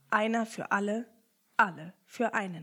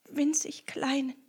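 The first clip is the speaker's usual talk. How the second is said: "winzig" repeated in a breathless whisper; 5 semitones higher than usual. Acted. The sad rat whispers "winzig kleinen", fading out.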